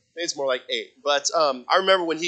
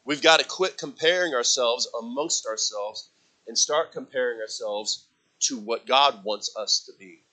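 normal talking voice

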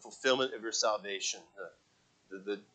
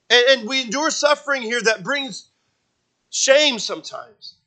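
loud, high, driving pulpit preaching; building fervent sermon climax; full-force preaching; 12 semitones higher, 15 dB louder than usual. Acted. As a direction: preach it hard to the congregation.

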